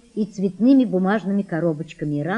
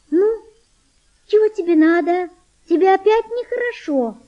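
put-on high-pitched, tender young girl; thin, tender voice; 11 semitones higher, 4 dB louder than usual. A narrator's reading. Girl asks a sick boy if he feels bad, thin tender voice. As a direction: speak in a thin, high, gentle voice. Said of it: "сказала она тонким, нежным голосом".

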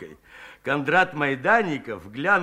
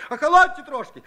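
much higher, louder pleading yell; insistent shout; 9 semitones higher, 5 dB louder, faster than usual. Acted. Frightened Shchukar shouts at the angry women, loud plea. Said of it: "упорно выкрикивал".

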